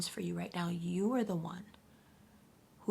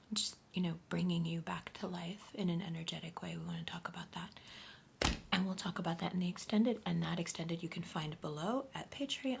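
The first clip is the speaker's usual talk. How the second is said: whispered reading; 2 semitones lower, 4 dB quieter than usual. Acted. Whispered spiritual reading to viewers.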